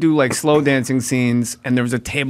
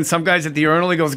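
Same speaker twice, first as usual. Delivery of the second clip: ordinary talk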